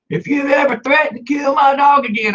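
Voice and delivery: high mock-angry neighbor's holler; imitated yelling. Neighbor yelling into the backyard about his dog, mock-angry yell. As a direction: yell a threat high, as if over a fence. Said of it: "yelling into my backyard".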